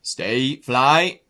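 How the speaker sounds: loud farmer's call to the dog; called command. Acted. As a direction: call out firmly to a sheepdog.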